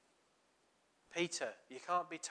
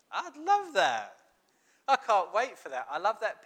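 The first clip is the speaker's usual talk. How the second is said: delighted gush; 6 semitones higher, 11 dB louder than usual. Acted. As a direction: swoon over the idea, much higher and louder. Imagining a barbecue with Jesus.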